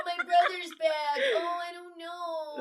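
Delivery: high, whiny, dithering voice with a yelp on "My brother's back"; whiny mock Kavik. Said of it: "is so like".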